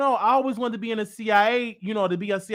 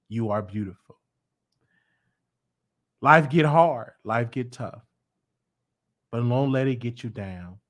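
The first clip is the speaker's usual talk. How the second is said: tender encouragement; 6 semitones lower than usual. Real, not acted. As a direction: comfort them like a parent. Tender address to viewers.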